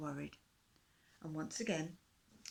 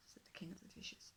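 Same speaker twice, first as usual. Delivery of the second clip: plain reading voice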